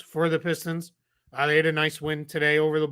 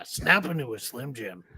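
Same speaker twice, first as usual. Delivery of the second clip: Macho Man Randy Savage; 2 semitones lower, 5 dB quieter than usual. Retold or imitated. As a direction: growl it like a hyped wrestler. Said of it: "Oh, it's the Macho Man. Randy Savage".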